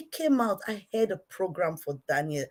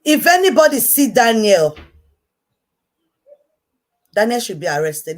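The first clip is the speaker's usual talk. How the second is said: much louder, higher public shout; loud call-out; 6 semitones higher, 10 dB louder than usual. Real, not acted. She calls on the public to find Daniel, shouting. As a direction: call it out to everyone.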